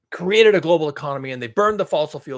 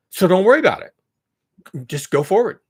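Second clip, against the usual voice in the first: mock reassurance; 4 semitones higher, 7 dB louder than usual. Mocking Nordhaus's message.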